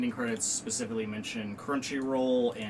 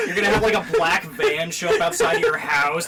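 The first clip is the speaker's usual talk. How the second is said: normal talking voice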